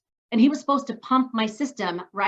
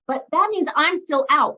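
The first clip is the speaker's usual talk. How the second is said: normal talking voice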